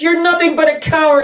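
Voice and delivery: high, loud, fast taunt; Dorothy scolding the lion, high and loud; scornful accusation. Retold or imitated. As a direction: sneer it at him. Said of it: "She says".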